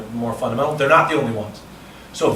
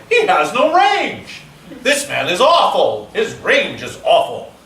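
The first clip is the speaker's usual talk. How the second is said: high, slow and louder, a scornful critic; a listener scoffing at a dull speaker, mock disdainful; mock audience verdict; 7 semitones higher, 7 dB louder, slower than usual. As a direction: play the judging listener, drawn out and hammed-up. Imagined audience judging a speaker.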